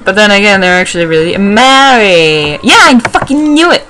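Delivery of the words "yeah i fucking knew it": high, thrilled outburst; triumphant exclamation. Real, not acted. Gamer proven right about Skitty, triumphant outburst.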